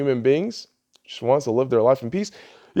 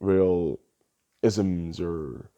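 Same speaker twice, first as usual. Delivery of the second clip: much lower, softer, droning mock of Steven Crowder; 9 semitones lower, 5 dB quieter than usual. Vlogger mocking Steven Crowder's claim of no beliefs, low and droning. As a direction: mumble it low like a dismissive pundit. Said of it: "Steven Crowder was getting on people with".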